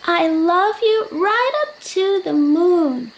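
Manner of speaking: eager bunny stretching up; excited little hare. Acted.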